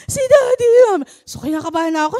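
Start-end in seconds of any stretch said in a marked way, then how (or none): 0.1-1.0 high, loud crying plea to mommy; sobbing shout of a scared daughter; crying child. Retold or imitated. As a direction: cry out for mommy in panic. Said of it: "ang ate A A ito umiiyak".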